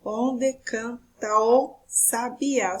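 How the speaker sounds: "Onde canta o sabiá" in slow, separate syllables. Shown as a demonstration. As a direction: scan the verse slowly, one syllable at a time. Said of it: "olha só o segundo verso".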